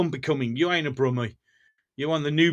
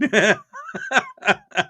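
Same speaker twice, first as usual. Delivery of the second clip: high, drawn-out giggling fit; amused laugh; 8 semitones higher than usual. Real, not acted. Insisting he's not joking, amused laugh. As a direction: crack up in a high voice. Joking about his links.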